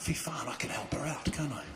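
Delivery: soft whispered inner voice; whispered thought. Retold or imitated. Comedian whispering his thought about helping. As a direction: think it aloud under your breath. Thinking to himself.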